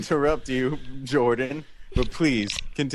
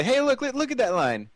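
excited; 13 semitones higher than usual. Retold or imitated. Excited pointing out line.

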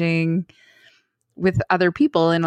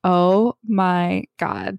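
slow stunned; much slower than usual. Retold or imitated.

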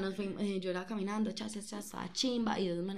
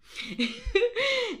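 high laugh; 10 dB louder than usual. Real, not acted. Se ríe de sí misma. (carcajada aguda)

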